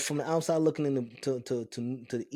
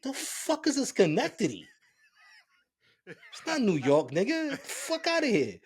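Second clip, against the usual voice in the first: incredulous dismissal; 8 semitones higher than usual. Retold or imitated. Mock disbelief at Schenectady.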